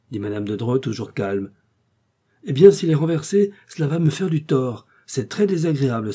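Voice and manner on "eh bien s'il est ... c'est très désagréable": higher and louder, worked up; fretful outburst. Acted. Husband frets to wife about his career, high and loud. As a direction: complain up high, rattled.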